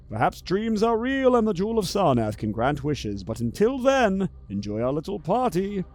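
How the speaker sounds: a masked aristocrat greeting his guests; stately host. Acted.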